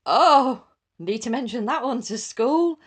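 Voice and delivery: high, loud "oh" of alarm; alarmed realisation. Retold or imitated. Mum realising school must hear this, loud and high. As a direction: gasp at the thought, loud and high. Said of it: "And I was like".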